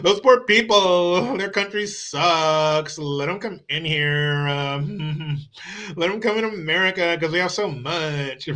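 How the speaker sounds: drawn-out, fake-weepy pity; mock bleeding-heart voter. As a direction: plead slowly with exaggerated sympathy.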